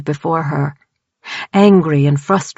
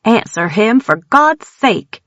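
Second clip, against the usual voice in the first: high, sharp scold; exasperated scolding; 5 semitones higher, 3 dB louder than usual. A narrator's reading. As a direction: snap at her impatiently. Estelle scolds, high, loud.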